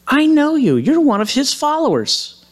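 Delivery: put-on high, loud accusing girl's voice; a servant girl calling Simon out. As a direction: point her out, sure of it. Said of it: "and she says".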